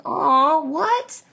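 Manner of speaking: high rising aw what; puzzled, squeaky reaction; mock confused.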